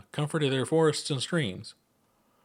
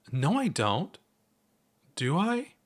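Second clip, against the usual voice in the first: flustered denial; 7 semitones higher, slower than usual. Acted. Chuck deflated.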